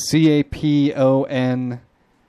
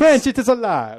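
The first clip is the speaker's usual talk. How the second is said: mock Italian accent; 10 semitones higher than usual. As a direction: proclaim it like a hammy Italian. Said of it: "That's my Italian accent speaking about French".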